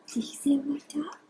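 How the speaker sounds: quick hushed question; whisper. Real, not acted.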